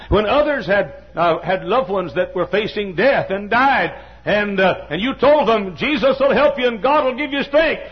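fervent preaching shout; preaching at full force. Acted. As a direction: rise to a shouted climax. Preacher building to climax.

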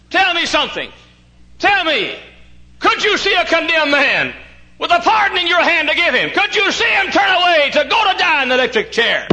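high, loud shouted challenge; full-force preaching. Real, not acted. Preacher challenging listeners about the condemned man, full-force preaching. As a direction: hurl the questions at the congregation.

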